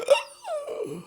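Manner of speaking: high sharp startled gasp; mock shocked gasp. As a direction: imitate a stunned intake of breath. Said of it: "And he just".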